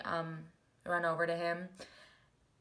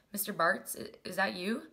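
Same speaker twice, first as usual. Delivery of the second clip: ordinary talk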